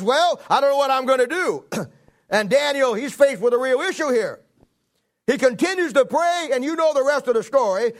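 high, loud fervent preaching; preaching at full force. Acted. Preacher telling Daniel's story to the church, fervent and loud. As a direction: drive the story home to the congregation.

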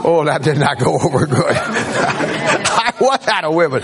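laughing while retelling the blunder; real laughter breaking into the words; amused laughing. Real, not acted. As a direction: chuckle through the punchline. Laughing at his own story.

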